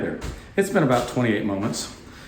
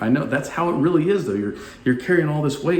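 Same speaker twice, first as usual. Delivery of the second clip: a higher, eager I know; emphatic reply; 6 semitones higher than usual. Retold or imitated. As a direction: press the point with energy. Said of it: "And I'm like".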